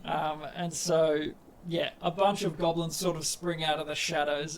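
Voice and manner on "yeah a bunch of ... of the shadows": ordinary talk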